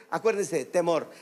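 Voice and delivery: normal talking voice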